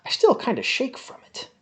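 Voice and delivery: ordinary delivery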